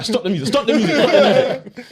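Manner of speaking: high-pitched, laughing shout to halt it; mock angry demand. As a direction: call out over the music, hammed up. Retelling his confrontation at the gym.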